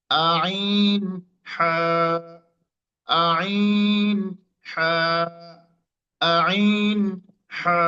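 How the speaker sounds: আইন squeezed from the middle of the throat, হা breathy; mid-throat letters demo. Shown as a demonstration. Teacher drills আইন and হা for students, squeezed. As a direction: press আইন from mid-throat, then a clean হা. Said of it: "এরপরে কণ্ঠের মাঝখান থেকে".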